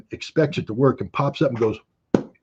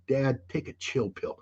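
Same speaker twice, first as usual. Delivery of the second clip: a hint of a bored kid, softer and slow; lightly voiced teen telling dad to relax; soft, slowed mock of his children; imitating his kids; 10 dB quieter, slower than usual. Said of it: "my kids have used it with me".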